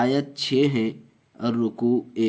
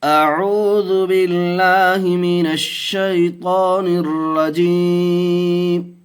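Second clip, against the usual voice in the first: slow, high, drawn-out chanted recitation; Quranic recitation; 5 semitones higher, 5 dB louder, much slower than usual. Shown as a demonstration. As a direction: intone each syllable long and melodic like tajweed recitation. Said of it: "teacher recites Quran opening".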